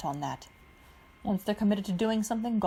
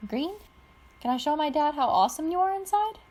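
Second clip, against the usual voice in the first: high, louder call up to the lion; 8 semitones higher, 4 dB louder than usual. A narrator's reading. Pidge calling up to her lion, asking to show her dad. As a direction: call out brightly to the lion inside. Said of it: "called out".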